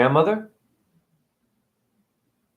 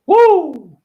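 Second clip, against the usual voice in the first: loud high woo; 12 semitones higher, 7 dB louder than usual. Real, not acted. Exclaiming over child abuse.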